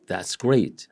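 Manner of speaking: "that's great" toned down, falling on great; unexcited "that's great"; different intonation. Shown as a demonstration. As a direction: let great drop, understated. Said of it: "The way I express myself is different".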